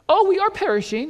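high, mock-British understatement. Preacher mimics disciples as if they were British, mock British. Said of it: "unless they were British".

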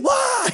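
high-pitched comic scream of fright; mock terrified shriek; startled yelp. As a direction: yelp as if jumped from behind. Acts Gideon startled by stranger.

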